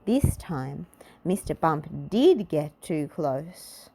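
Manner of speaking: plain reading voice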